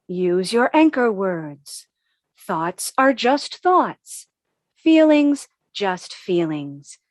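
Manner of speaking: slow, sighing meditation cadence; calm mantra. Retold or imitated. Anxious walker slowly reciting her mindfulness mantra. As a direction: ease each phrase out like a breathing coach.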